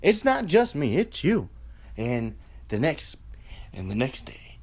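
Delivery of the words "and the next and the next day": eerie whispered repeat. Acted. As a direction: whisper it like a threat. Ominous repetition.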